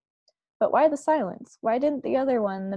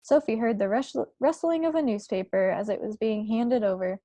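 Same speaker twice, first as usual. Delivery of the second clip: ordinary delivery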